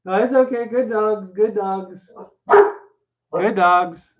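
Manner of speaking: high-pitched, gentle pet talk; real coaxing sing-song to calm animals; soothing the dogs. Real, not acted. Reader calms dogs interrupting the story, high soothing voice.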